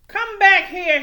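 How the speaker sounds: loud, high honk of a yell; angry truck shouting. A narrator's reading. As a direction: bellow after the fleeing scooter. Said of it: "he honked".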